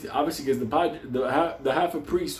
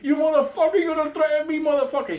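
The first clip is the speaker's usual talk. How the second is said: furious yell; 14 semitones higher, 4 dB louder, faster than usual. Retold or imitated. Benny yelling while beating devil.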